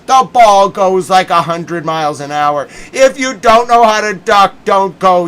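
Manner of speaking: loud ranting with a laugh of disbelief; angry rant. Real, not acted. Fan demanding the net come down, angry. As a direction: demand and mock at full volume. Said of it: "I've never been able to yell about that net".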